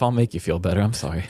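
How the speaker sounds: ordinary talk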